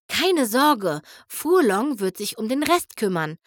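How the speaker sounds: plain reading voice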